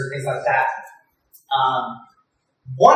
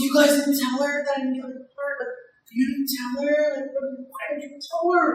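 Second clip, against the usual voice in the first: high, pinched incredulous whine at the family; aghast, pitch jumps up; shocked disbelief; 9 semitones higher, 8 dB quieter than usual. Retold or imitated. Shocked at family not telling.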